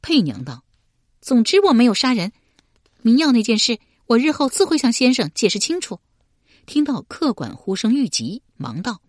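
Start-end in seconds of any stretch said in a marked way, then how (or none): 1.2-6.0 急切. A narrator's reading. 佩娘急切辩白.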